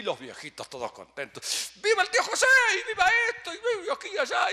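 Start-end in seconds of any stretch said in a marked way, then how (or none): 1.8-4.5 very high, very loud cheer; festive cheering. Retold or imitated. Cousins cheering the old aunts, loud joyous shout. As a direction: shout it like a party crowd.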